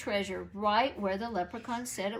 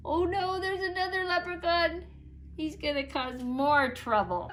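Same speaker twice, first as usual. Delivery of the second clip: higher, louder worried cry; alarmed; 6 semitones higher, 3 dB louder than usual. A narrator's reading. Sidney dismayed at another leprechaun, worried. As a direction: panic at the news.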